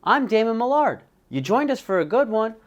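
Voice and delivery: high, loud announcer delivery; show host intro. Acted. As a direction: open the show big and high.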